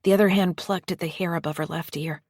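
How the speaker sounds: plain reading voice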